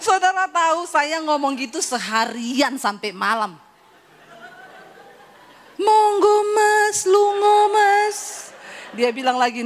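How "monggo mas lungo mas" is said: higher, louder honeyed voice; sweet Javanese wife. Pretend. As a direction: play the refined Solo wife. Refined wife role.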